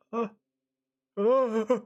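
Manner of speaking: frightened gasp. Acted. Old man recoils.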